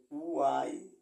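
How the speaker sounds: ওয়াই hit loud with a push on the start. Shown as a demonstration. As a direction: punch the start of ওয়াই hard. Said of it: "একটা ধাক্কা থাকবে". (ধাক্কা দিয়ে ওয়াই)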